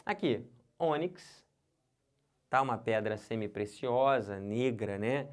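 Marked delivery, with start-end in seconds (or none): 0.8-1.5 "ônix" slowly as Ô-nix; stress on Ô. Shown as a demonstration. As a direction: lean on the first syllable of "ônix". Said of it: "é ônix". ("ônix" com a tônica no Ô)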